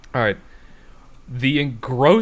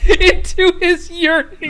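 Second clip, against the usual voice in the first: laughing; 8 dB louder than usual. Real, not acted. Breaks up reading.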